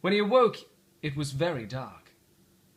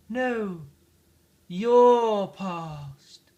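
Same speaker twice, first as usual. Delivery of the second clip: ghostly spirit voice; 5 semitones higher, 9 dB louder, much slower than usual. Acted. The spirit answers.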